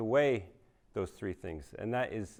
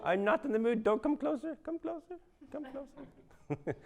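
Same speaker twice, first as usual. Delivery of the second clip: mimicking the ex-wife; 12 semitones higher than usual. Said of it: "She'd be like".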